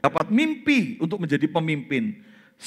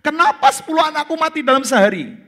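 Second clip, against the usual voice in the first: higher, anguished cry to God; Job crying out; 10 semitones higher, 4 dB louder than usual. Retold or imitated. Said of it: "Kalau dia teriak". (teriakan pilu kepada Tuhan)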